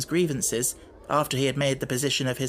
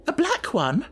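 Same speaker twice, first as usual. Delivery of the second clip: much higher, faltering echo; bewildered stammer; 10 semitones higher than usual. Acted. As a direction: repeat it in confusion. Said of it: "stammered again".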